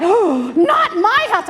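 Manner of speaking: loud, high gasp of denial; gasp, then protest loudly as an outraged mom; shocked mother. Acted. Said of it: "the mother's like".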